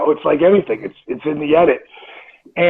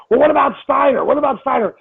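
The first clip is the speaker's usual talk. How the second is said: mock yell; 8 semitones higher, faster than usual. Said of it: "he kept yelling so much".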